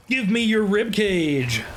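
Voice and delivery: high, drawn-out hammed-up cry; mock demand. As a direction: stretch every word in a mock war cry. Mock war cry while looting body parts.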